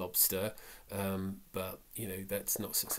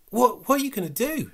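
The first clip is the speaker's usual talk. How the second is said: much higher, louder horrified cry; alarmed cry; 13 semitones higher, 11 dB louder than usual. Acted. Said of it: "he cried".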